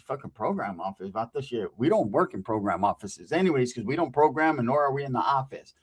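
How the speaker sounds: low, soft scoffing voice; dismissive grumble. Retold or imitated. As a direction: mutter it in disgust. Mocking other con's answer.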